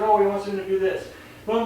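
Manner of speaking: ordinary talk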